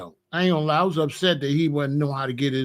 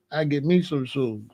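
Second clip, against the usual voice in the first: ordinary delivery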